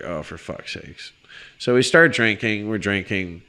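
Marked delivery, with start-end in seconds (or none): 0.0-1.1 low, soft, fed-up aside; muttered exasperation. Retold or imitated. Dreading the woman coming back, a soft fed-up mutter. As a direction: mutter it under your breath, defeated. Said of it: "I'm just like".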